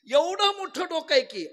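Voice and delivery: loud, slow indignant outburst; a husband shouting back at his scolding wife, loud and slow; angry husband. Retold or imitated. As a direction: bellow it like an offended husband patting his big head. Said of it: "तो रागाला आला".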